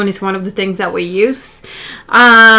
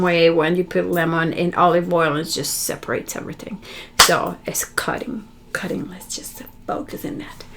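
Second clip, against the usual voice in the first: hushed focus; 6 semitones lower, 11 dB quieter than usual. Real, not acted. Quiet focus on cutting energy.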